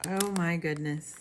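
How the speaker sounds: usual speaking voice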